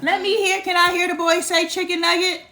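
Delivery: loud playful coaxing of her son; excited coaxing. Real, not acted. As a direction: egg him on to say it. Mom coaxing child loudly.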